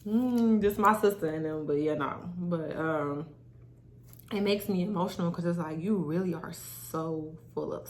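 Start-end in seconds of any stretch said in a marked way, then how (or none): none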